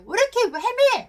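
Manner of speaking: re-enacted snap at husband, high and loud; angry outburst. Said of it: "막 그러면서 막 화를 내고". (불안해서 버럭 짜증 내는 말투)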